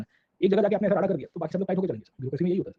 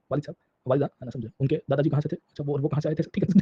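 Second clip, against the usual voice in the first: ordinary talk, nothing marked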